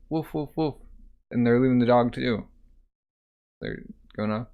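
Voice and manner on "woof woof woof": high, soft spoken woofs; mock dog bark. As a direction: bark softly like a cartoon dog. Voicing the dog's farewell bark.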